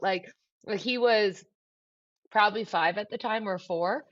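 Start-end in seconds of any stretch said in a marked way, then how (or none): none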